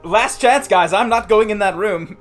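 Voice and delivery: loud, high panicked refusal; shouting. Acted. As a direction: yell it like you're terrified to go in. Character refuses to enter room.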